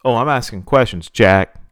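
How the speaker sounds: loud, angry challenge; seething. A narrator's reading. Virgil challenges the promoter, loud and seething. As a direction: push back hard, punching the last word. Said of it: "Virgil seethed".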